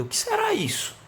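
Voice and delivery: high, far-off questioning voice; high curious question about a noise; curious asker. Retold or imitated. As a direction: voice a puzzled bystander pointing at the box. Someone asks about noisy box.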